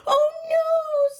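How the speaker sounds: high alarmed cry of a cub; shout. Acted. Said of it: "Zeke shouted".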